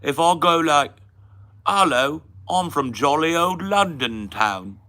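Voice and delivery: loud, high, cheery mock Cockney; Cockney accent. Shown as a demonstration. Introducing himself from jolly old London town, Cockney accent. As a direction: greet us in hammed-up London Cockney. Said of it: "Did you want a Cockney accent?".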